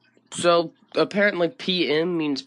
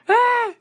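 sharp shriek; 6 dB louder than usual. Real, not acted. Sudden outburst.

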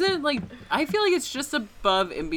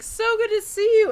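high, loud, fast gushing greeting; overly sweet fan voice; gushing stranger; 12 semitones higher, 7 dB louder, faster than usual. Retold or imitated. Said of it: "this person came over to me and was like".

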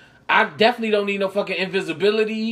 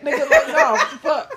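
much higher, louder squeak of disbelief; incredulous squeal; 3 dB louder than usual. Retold or imitated. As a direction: jump way up in pitch in mock outrage. Mock reaction to Italian greeter.

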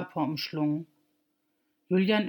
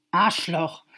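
angry curse; 5 dB louder than usual. Acted. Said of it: "Sie war so wütend".

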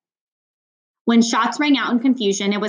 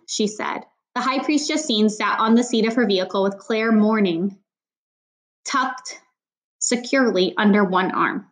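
ordinary delivery, nothing marked

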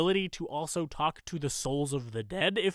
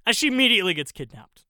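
fast, louder, higher outburst on "immediately"; quick exclamation; 4 semitones higher, 8 dB louder, faster than usual. Real, not acted. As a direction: rattle off "immediately gets kidnapped" loudly. First take of repeated exasperated line.

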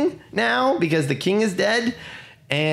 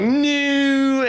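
high, louder mock wail; mock cry of no; 12 semitones higher, 3 dB louder than usual. Soldiers reacting to the king's death, high mock cry. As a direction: cry out in hammed-up despair. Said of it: "they're like".

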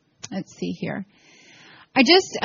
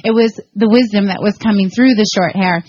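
ordinary delivery

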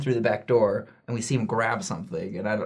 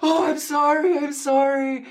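put-on high, louder old-lady apology; a much higher, fluttery grandma voice saying sorry; grandma apologising; 4 dB louder than usual. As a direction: play the flustered grandma in a high voice.

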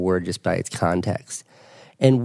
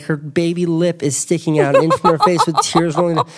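laughing; 7 semitones higher than usual. Real, not acted. Laughing retelling.

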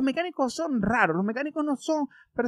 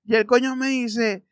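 ordinary talk

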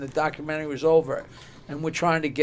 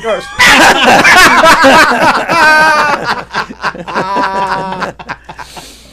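hearty laugh; 6 semitones higher, 12 dB louder than usual. Real, not acted. Laughs at own punchline.

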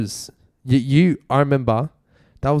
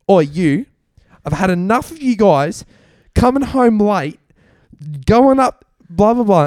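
hammed-up complaining neighbour; mock Karen complaint; 8 semitones higher, 5 dB louder than usual. The Karen next door scolding the noisy guys.

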